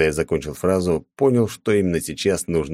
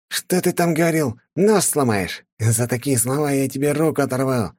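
злой высокий голос сквозь зубы; огрызнуться, скалясь от ярости; оскалившись; 9 semitones higher than usual. A narrator's reading. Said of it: "оскалился парень". (злобно оскалившись)

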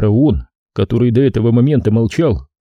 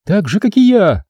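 retort; 9 semitones higher than usual. Acted. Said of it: "парировала Дренна".

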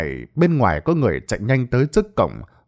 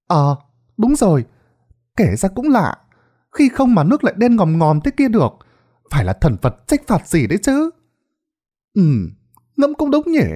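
agreeing villagers; 8 semitones higher than usual. Acted. Villagers agree it's strange.

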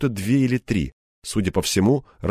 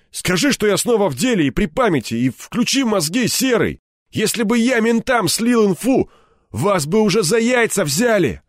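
high, heated argument; barking insistence; 11 semitones higher, 3 dB louder than usual. A narrator's reading. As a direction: keep barking at the boss to make him check. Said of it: "рявкнул Кирпич". (рявкая, горячо настаивать)

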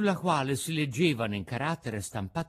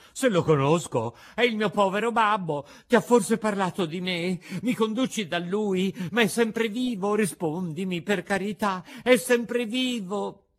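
higher, louder, eager questioning; urgent excitement; 5 semitones higher, 5 dB louder than usual. A narrator's reading. Pinocchio begging the pigeon for news of his father, urgent excitement. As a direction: fire off anxious, pleading questions.

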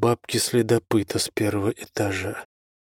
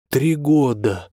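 outraged shout; 4 semitones higher, 4 dB louder, slower than usual. Acted. Thug outraged at the sentence.

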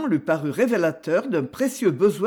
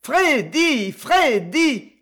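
high, loud calling of the name; crowd clamor; 7 semitones higher, 4 dB louder, much slower than usual. A narrator's reading. Bar crowd summoning Freddy to perform, clamoring. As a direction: call out from all sides for a performer. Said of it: "clamait-on de toutes parts".

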